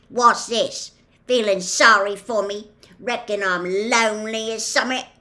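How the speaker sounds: loud, gruff, accusing questions; grumpy. A narrator's reading. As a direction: challenge them grumpily in a rough accent. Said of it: "said Hagrid grumpily".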